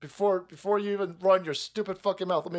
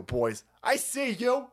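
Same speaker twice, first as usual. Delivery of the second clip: usual speaking voice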